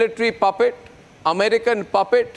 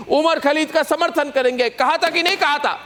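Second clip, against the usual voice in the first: racing through the words, voice higher; rapid-fire accusation; 4 semitones higher, much faster than usual. Real, not acted. Host rushes the quote at the panelist, fast and pressing. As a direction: hammer the charge home fast.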